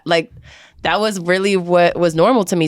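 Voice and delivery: ordinary talk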